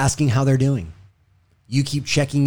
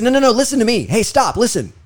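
higher, louder, cutting in to be heard; agitated, raised-voice interruption; heated reaction; 10 semitones higher, 5 dB louder than usual. Acted. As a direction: act out losing your cool and demanding attention. Acting out losing his cool.

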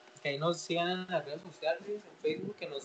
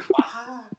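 slap sound; 6 semitones higher, 9 dB louder than usual. Retold or imitated. Second slap.

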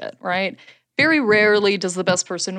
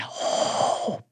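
normal talking voice

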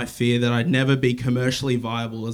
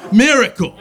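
shouted Miracle! loud and high; shouting capitalized words; 9 semitones higher, 9 dB louder than usual. Shown as a demonstration. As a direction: bellow Miracle! as if written in caps. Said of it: "it's in caps, so I have to".

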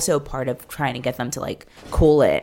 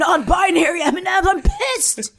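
much higher, squeaky mock-indignant voice; mock outraged conservative; 3 dB louder than usual. Mocking conservatives over non-binary M and M's, shrill and much higher. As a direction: lampoon a pearl-clutching boycotter.

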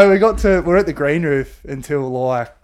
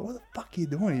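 hushed retold question; 4 semitones higher, 10 dB quieter, faster than usual. In mum's quiet car, to the swaying mate, hushed. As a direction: keep it down so mum doesn't hear. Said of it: "it's dead quiet in the car home".

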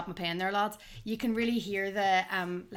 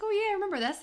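high-pitched fond recollection; high nostalgic voice; 12 semitones higher, faster than usual. Retold or imitated. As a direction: say it up high, remembering fondly. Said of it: "I was like".

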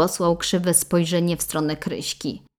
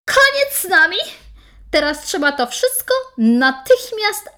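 high, loud and crying; furious through tears; tearful anger; 11 semitones higher, 6 dB louder than usual. Acted. Bride ends marriage tearfully.

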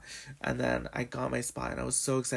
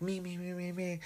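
imitating eager volunteer; 6 semitones higher, 4 dB quieter than usual. Fan begging to be picked for a question, mock-eager. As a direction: re-enact a pleading hand-waver in a high voice.

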